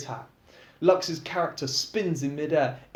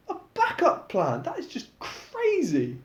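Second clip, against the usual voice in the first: incredulous; 11 semitones higher than usual. Acted. Disbelief at backup plan.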